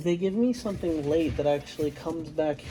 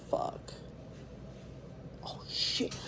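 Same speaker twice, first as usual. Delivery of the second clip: whispering; 13 dB quieter, slower than usual. Real, not acted. Whispered at night.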